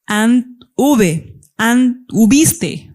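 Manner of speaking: "And-", pause, then "V" (uve) and "hubiste" punched; slow split, stem "And-" then emphatic ending "hubiste". Shown as a demonstration. Teacher separates stem from ending for students, endings "V" and "hubiste" punched apart. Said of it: "cuál es la desinencia".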